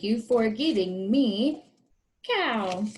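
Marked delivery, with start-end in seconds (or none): none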